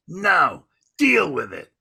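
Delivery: mock defiant brush-off, falling hard at the end; blunt refusal. Volunteer refuses comedian's request for a bill, blunt refusal.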